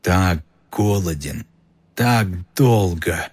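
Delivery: a hungry monster hissing in the walls; icy murderous voice. Acted. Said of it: "Снова этот голос".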